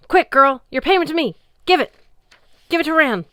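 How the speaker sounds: frantic high, loud yelling; panicked shout. Acted. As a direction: yell it in a total panic. Panicking giant yells at Sam.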